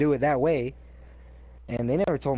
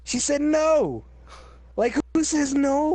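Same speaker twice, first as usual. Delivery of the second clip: high, slow, incredulous exclamation; indignant squeal at a coworker's refusal; outraged disbelief; 14 semitones higher, 5 dB louder, much slower than usual. Real, not acted.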